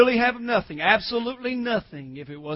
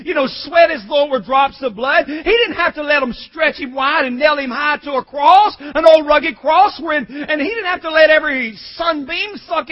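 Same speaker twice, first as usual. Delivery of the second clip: loud, high preaching building to a shout; impassioned pulpit crescendo; full-force preaching; 5 semitones higher, 7 dB louder than usual. Real, not acted. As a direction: build relentlessly to a shouted peak.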